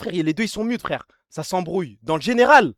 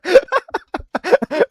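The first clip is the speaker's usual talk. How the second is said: high, real laughter; laughing fit; 9 semitones higher than usual. Real, not acted. Streamer amused by a self-insult, high laughing fit. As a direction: keep cracking up, pitched high.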